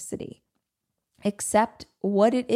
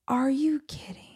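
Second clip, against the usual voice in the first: hushed, higher-pitched incredulous whisper; peeved disbelief; 6 semitones higher than usual. Retold or imitated. Traveler retelling her airline mix-up, peeved disbelieving whisper. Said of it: "really peeved".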